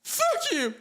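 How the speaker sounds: squeaky high mock curse; mocking hater. Streamer imitating haters cursing him, squeaky and high. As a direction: squeal it like a cartoon brat. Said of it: "And they're like".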